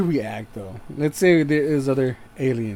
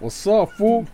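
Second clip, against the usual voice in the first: higher, louder taunt; gangster challenge; 8 semitones higher, 6 dB louder, slower than usual. Retold or imitated. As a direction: taunt like a street tough. Gang-style challenge, joking.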